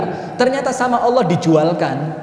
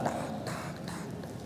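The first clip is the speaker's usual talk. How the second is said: breathy tak tak of wings flying off; soft whispered wingbeats; whispered flapping sound; 22 dB quieter, faster than usual. Retold or imitated. Bird flies off with yarn.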